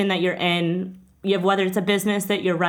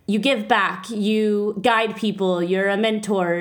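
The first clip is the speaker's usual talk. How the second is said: ordinary delivery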